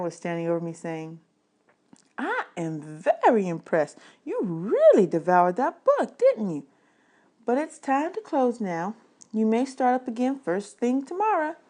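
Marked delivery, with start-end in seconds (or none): 2.2-11.6 librarian voice. Acted. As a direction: play the impressed, gentle librarian.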